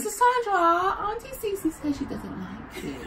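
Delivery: put-on high giggly kid voice; child voice. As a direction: play the cheeky nephew. Voicing the nephew.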